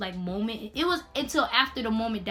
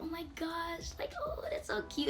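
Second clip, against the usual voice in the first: very high, soft squeal of delight; imitating happy excitement; 7 semitones higher, 9 dB quieter than usual. Security guard delighted about the baby, imitated high soft cooing. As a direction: coo it high and hushed. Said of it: "all excited and all of that".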